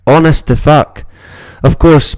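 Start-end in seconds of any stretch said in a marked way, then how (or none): none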